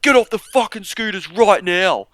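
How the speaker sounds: loud, high barked command; cop yelling. Retold or imitated. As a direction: yell it like a furious cop. Cop yelling at scooter riders.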